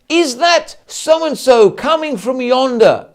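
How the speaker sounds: loud, slow stage declaiming; theatrical feeder line. Acted. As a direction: proclaim it like an actor on stage. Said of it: "his feeder line".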